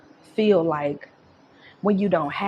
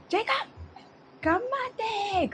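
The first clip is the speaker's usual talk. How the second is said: put-on very high, soft sing-song call to a child; calling a toddler; 5 dB quieter than usual. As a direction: coax the little boy over gently. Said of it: "And I used to be like".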